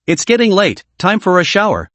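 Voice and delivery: ordinary delivery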